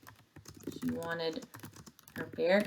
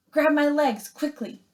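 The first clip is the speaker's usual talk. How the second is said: higher, louder urgent command; urgent call; 8 semitones higher, 5 dB louder than usual. Acted. Robot Roz calling to the bears to grab her legs, urgent shout. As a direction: call for help fast.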